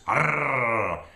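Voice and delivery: put-on short growling roar at the kids; monster roar. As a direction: roar like a wild thing. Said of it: "they roared their terrible roars".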